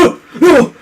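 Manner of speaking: high, loud mock gasps of shock; imitated cries of alarm; startled yelps. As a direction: yelp as if your body jolts. Retold eye suddenly jutting sideways.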